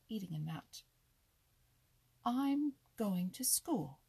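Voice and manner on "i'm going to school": slow, raised, self-important announcement; dignified. A narrator's reading. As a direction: announce it loudly with great dignity. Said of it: "with a great deal of dignity".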